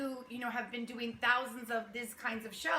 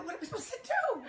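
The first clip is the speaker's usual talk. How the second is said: mock helpless question; 9 semitones higher, faster than usual. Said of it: "I go".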